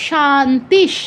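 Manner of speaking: শান্তিশ with long "aa", closed on sh; visarga as sh. Shown as a demonstration. Teacher answering own question, শান্তিশ ending in sh. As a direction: end শান্তিশ in a vowelless palatal sh. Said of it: "তালব্য স থাকায়".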